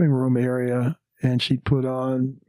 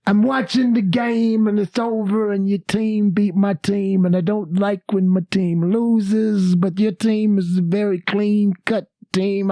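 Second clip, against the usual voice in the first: Bill Cosby impression; 7 semitones higher than usual. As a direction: ramble on like a chatty Cosby. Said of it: "then he started laughing".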